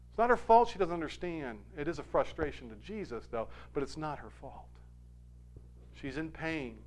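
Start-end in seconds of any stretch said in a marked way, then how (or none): none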